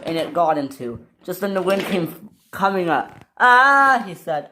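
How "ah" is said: loud high "ah"; high yell of alarm; acted scream. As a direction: cry out as the wind hits. Wind hits the engine.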